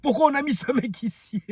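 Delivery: high, hammed-up disbelief at this guy; imitating outraged viewers. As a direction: squeal it like scandalised viewers. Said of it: "ils sont en train de regarder la vidéo, ils disent".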